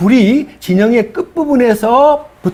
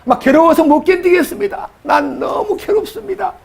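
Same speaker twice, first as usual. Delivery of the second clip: high, pleading moan of a worn-out leader; anguished complaint; 11 semitones higher than usual. Retold or imitated. Moses groaning to God, high pleading whine.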